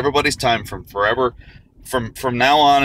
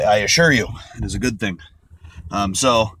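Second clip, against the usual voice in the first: ordinary delivery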